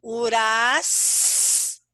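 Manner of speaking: slow, drawn-out syllables with clear pauses; teacher separating a word into its sounds. Shown as a demonstration. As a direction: stretch each syllable and hold the hissed S. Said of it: "Eu tenho três sílabas".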